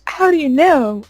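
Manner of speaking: put-on teen caught out, high and startled; startled question. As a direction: blurt it out caught off guard. Retold shock at mom's guess.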